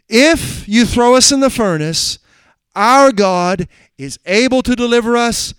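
triumphant sermon; preaching at full force. Acted. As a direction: proclaim their faith word by word. Preaching the confidence.

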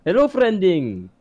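put-on high, loud, drawn-out Indian accent. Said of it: "hit us with the accent".

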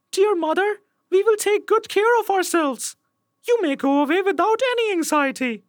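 put-on very high-pitched kid voices; little goat kids. A narrator's reading. Kids reassuring their mother, very high little voices. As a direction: squeak it like eager little children. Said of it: "The kids said".

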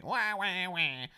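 high hammed-up baby wail; high mock crybaby wah wah wah.

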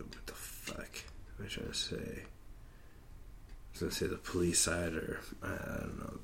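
whispered aside to himself; muttering to himself. Real, not acted. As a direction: mumble it under your breath. Muttering to himself, losing his train of thought.

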